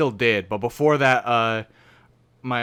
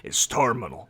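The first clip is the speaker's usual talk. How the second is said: low, grave doctor voice; Vinny Wine Sauce bit; 7 semitones lower, 7 dB quieter than usual. Retold or imitated. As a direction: deliver bad news like a doctor. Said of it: "sounds like you're doing a Vinny Wine Sauce bit".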